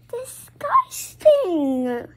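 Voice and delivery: high, long drawn-out "disgusting"; grossed-out disgust. Real, not acted. Grossed out by bee story.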